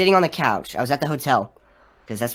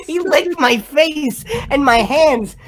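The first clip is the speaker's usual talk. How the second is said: higher and slow, disgusted rant; triggered; 8 semitones higher, 4 dB louder, slower than usual. Real, not acted. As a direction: spit it out, still fuming. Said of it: "I got really triggered".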